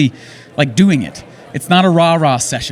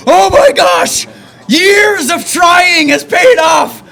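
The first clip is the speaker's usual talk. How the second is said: very high, slow, shouting with joy; ecstatic shout; 12 semitones higher, 6 dB louder, much slower than usual. Retold or imitated. Phoning family after the job offer, ecstatic shout. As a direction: let years of effort burst out loud.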